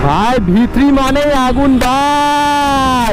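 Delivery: stretched high excited cry; hyped drawn-out shout. Real, not acted. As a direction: belt it out slow and high. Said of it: "hyped chant while riding".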